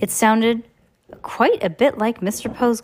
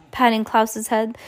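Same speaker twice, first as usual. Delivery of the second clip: ordinary delivery, nothing marked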